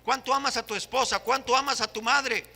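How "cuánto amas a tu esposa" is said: ordinary talk, nothing marked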